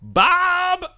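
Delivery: high, loud character yell; imitated yell. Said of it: "and she yells".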